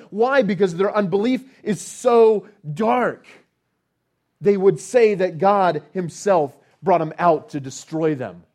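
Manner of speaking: loud, high, indignant sermon delivery; preaching at full force. Acted. Preacher denouncing Israel's twisted theology, preaching at full force. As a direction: drive it home with rising indignation. Preacher rails against unbelief.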